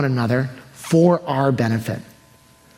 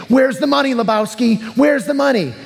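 angry demand; 10 semitones higher than usual. Acted. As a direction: shout it like you're choking the debt out of him. Servant choking debtor, demanding money.

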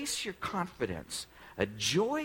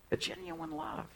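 soft high echo; 4 dB quieter, slower than usual. Acted. Preacher repeats key phrase softly.

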